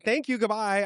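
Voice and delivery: high, over-polite mock farewell; retold awkward brush-off. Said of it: "I was like".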